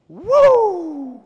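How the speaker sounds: high, full-throated celebratory whoop; loud whoop. Retold or imitated. Son imitated whooping after his somersault. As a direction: belt it out like a kid landing a stunt. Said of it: "this is gonna be a little loud".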